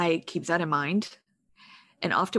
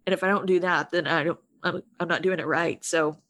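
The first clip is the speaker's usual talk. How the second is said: ordinary talk, nothing marked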